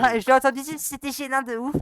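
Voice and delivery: imitating a girl. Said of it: "je vais imiter les meufs".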